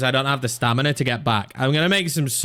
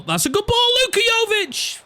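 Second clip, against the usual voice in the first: excited shout. Real, not acted. Calling a big chance.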